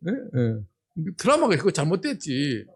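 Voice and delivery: ordinary talk, nothing marked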